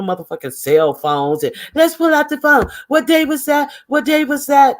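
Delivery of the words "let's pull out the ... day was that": high, mimicked argumentative voice; mock her insistent questioning up high; mock squabbling. Woman mimicked demanding proof from the phones, mock squabbling.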